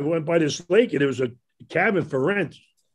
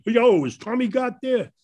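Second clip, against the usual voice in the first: Brooklyn yell; 7 semitones higher than usual. Retold or imitated. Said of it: "I yell out right out of Brooklyn".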